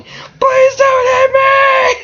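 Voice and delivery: very high, drawn-out mock scream; panicked scream. Wolf dreads a slap after confessing, panicked scream. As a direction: scream it in comic panic, stretched out. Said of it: "he's literally just screaming".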